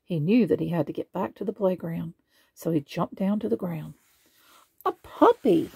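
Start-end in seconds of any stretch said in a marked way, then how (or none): 4.8-5.7 high, loud excited squeal; delighted squeal. A narrator's reading. Little girl spots a puppy, high squeal. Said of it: "someone squealed".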